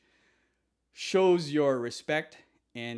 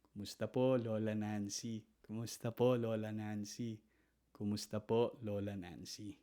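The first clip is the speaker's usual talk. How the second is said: much softer, humble lilt rising on po; deferential greeting; 4 semitones lower, 7 dB quieter than usual. Shown as a demonstration. Greeting fiance's grandmother, soft and respectful. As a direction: speak gently, bowing to grandmother. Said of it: "Grandmother is lola".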